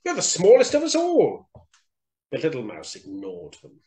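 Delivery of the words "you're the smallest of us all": high, loud squeaky jeer; mocking mice. A narrator's reading. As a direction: tease as a scornful little mouse. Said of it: "they laughed".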